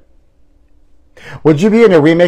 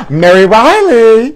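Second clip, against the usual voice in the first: gasp; 7 semitones higher, 4 dB louder, slower than usual. Real, not acted. Gasping recognition of film title.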